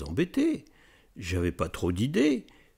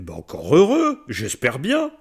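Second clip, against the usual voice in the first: indignant retort; 4 semitones higher, 7 dB louder than usual. Retold or imitated.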